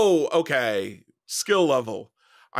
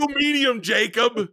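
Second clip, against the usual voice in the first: exasperated shout; 9 semitones higher than usual. Real, not acted. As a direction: scold him sharply across the room. Wife scolds husband on podcast.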